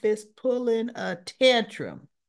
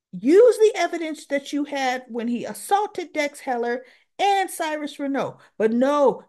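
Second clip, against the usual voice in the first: higher, louder imitated telling-off; retold scolding; 6 semitones higher, 5 dB louder than usual. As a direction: lecture her, pushing each accusation. Molly scolding Anna in retold quarrel.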